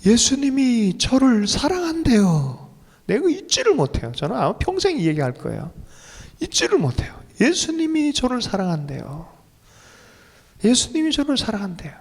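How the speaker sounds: high, plaintive voice of a weeping believer, retold; imitated tearful testimony. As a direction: act out an overwhelmed man's tearful confession. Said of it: "왜 이렇게 우시냐고". (울먹이듯 높은 목소리로 고백하는 흉내)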